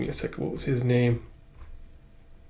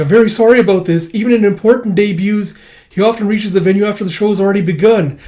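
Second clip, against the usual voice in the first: loud apologetic messenger; 7 semitones higher, 6 dB louder than usual. Acted. Apologizing for the late master.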